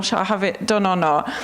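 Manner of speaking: ordinary delivery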